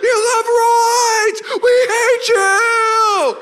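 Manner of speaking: high, drawn-out heckling scream; hammed-up hateful fan shriek, words stretched; hostile crowd yelling. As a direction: yell abuse from the stands. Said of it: "we'd be yelling".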